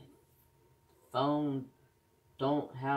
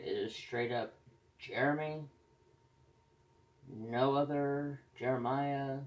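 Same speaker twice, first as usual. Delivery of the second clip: normal talking voice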